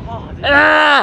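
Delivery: loud, high frightened cry; scared yell. Real, not acted. Scared passenger on motorbike.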